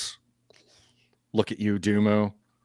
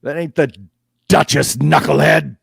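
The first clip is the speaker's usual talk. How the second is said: much higher and louder jeering guard voice; mocking guard; 7 semitones higher, 12 dB louder than usual. Fish guard mocking the party's bluff, loud high jeer. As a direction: sneer it through laughter at the fool. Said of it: "They say".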